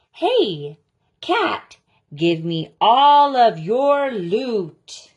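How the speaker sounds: loud, high mock-bossy wizard voice; wizard demanding loot. Storyteller voicing a wizard demanding a cat's loot, loud and bossy. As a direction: bark it like a greedy little wizard.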